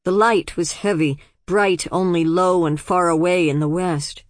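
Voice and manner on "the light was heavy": ordinary delivery, nothing marked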